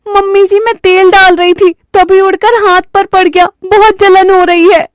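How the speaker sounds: high, whiny put-on pain; whining bride. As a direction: keep up the act of a burnt hand. Bride continues fake pain. (ऊँची आवाज़ में दर्द का नाटक)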